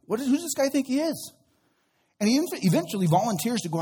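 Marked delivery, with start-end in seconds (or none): 0.0-1.3 put-on young David scoffing. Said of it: "And he's like".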